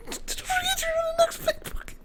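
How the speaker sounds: very high voice breaking with joy; overjoyed falsetto sob; crying fan squeal. Retold or imitated. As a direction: squeal it near tears.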